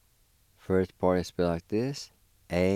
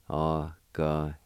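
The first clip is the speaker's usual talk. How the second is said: normal talking voice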